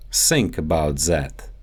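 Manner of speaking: "Think" as sink, "that" as zat; Russian S and Z for TH in "Think about that"; Russian accent. Shown as a demonstration. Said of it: "The T H sound".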